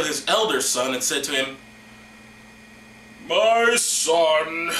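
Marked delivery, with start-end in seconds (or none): 3.3-4.7 loud, drawn-out mock-solemn call; old patriarch calling. Blind old Isaac calling his elder son, loud theatrical summons. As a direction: call out grandly, stretching both words.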